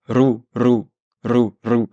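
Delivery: "rou" trilled like a pigeon coo; rolled r. Shown as a demonstration. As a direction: roll the r in "rou". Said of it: "Qu'est-ce qu'il fait un pigeon".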